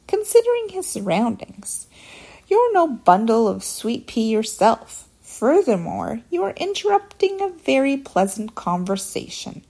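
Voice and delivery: loud, pointed telling-off; sharp scolding. A narrator's reading. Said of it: "she spoke sharply to the lamb".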